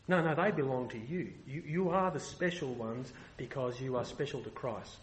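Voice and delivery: normal talking voice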